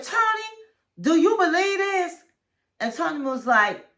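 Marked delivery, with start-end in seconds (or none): 0.0-2.1 high indignant appeal. Retold or imitated. Retold appeal to Tony.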